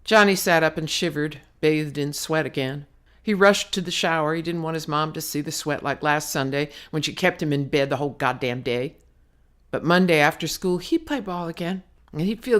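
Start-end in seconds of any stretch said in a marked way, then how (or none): none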